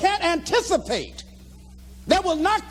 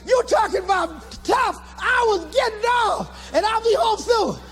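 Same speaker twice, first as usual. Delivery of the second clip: high, keyed-up retelling; excited interrupting; 7 semitones higher than usual. Retold or imitated. Man telling girlfriend he'll be home soon, excited. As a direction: talk over the bad news in high excitement. Excited phone talk, not listening.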